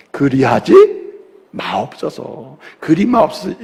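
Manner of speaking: 낮고 느리게, 점잖은 옛말투; 성경 낭독하듯 점잖게 늘여서; 고상한 번역투. Shown as a demonstration. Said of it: "너무 고상하게 번역이 됐어요". (점잖은 옛 성경 말투로 낮고 느리게)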